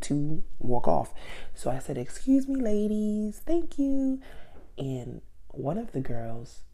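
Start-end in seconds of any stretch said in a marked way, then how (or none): 2.0-4.2 high, sweet and polite; a high sugary excuse-me; polite sing-song. Retold or imitated. To two women in her space, high polite thanks.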